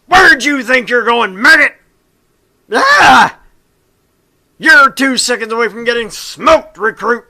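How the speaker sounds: drill sergeant bellow. Acted. Sergeant screaming at the new recruit by the door, furious drill-sergeant yell. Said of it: "you're greeted by".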